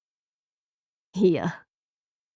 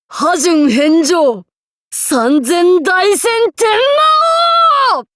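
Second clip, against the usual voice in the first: shouted attack name; 7 semitones higher, 4 dB louder than usual. Acted. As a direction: roar out the ultimate move. Ultimate attack call.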